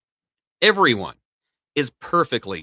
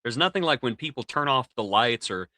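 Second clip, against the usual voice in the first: ordinary talk, nothing marked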